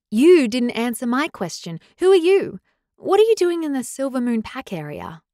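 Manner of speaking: loud, high, insistent questioning; stubborn. A narrator's reading. Girl demanding the stranger's identity, stubborn. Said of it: "she asked stubbornly".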